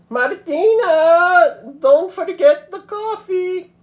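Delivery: loud, very high, slow grandmother call. A narrator's reading. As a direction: call her down from the balcony. Grandmother calling from balcony.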